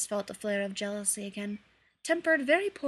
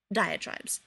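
ordinary delivery, nothing marked

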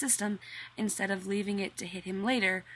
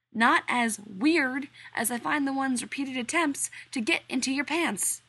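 higher, louder bitter jab; jealous retort; 6 semitones higher, 5 dB louder than usual. Acted. Rodney snapping at John about the flirting workman, higher and louder. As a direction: snap back jealously.

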